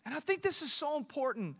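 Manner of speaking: high, ringing preacher's call; preaching at full force. Acted. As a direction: preach it out to the congregation.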